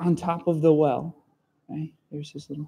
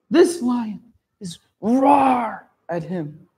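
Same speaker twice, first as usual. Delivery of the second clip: ordinary talk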